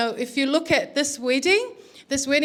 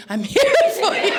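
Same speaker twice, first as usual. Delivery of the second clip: high, louder, breaking into laughter; mock comforting; 10 semitones higher, 5 dB louder than usual. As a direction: imitate consoling Daddy while cracking up. Sar comforting Daddy, mimicked.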